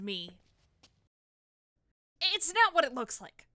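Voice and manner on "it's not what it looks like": flustered protest. Acted. Caught snooping, flustered excuse.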